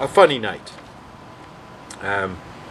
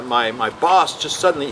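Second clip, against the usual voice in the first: ordinary delivery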